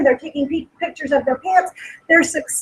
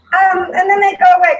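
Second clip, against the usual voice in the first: higher and louder, voice catching with real tears; choked-up real crying, pitched up; tearful; 5 semitones higher, 6 dB louder, faster than usual. Real, not acted. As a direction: push through the tears on the words. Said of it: "I'm still crying".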